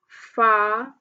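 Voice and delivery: "file" stretched as FA, pause, IL; split into syllables. Shown as a demonstration. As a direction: sound out "file" in two separate beats. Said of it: "put your hand like this".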